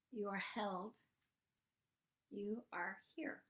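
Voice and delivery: soft, gentle meditation guidance; guided meditation. Acted. Host guiding listeners to feel the ground, gentle meditation voice. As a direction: guide them into stillness. Leads a somatic moment.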